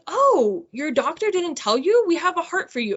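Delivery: a high, lightly voiced casual nurse; mock offhand delivery of big news; imitating the nurse. Nurse casually telling patient a heart is ready, lightly mocked.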